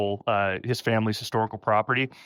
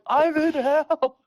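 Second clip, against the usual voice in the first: much higher and very slow, stretched-out hammed-up despair; drawn-out mock wail; much slower than usual. Podcaster repeats Byron's line to the cohost, hammed-up slow wail. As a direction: wail it like a melodramatic damned soul. Retold Byron shooting up from bed.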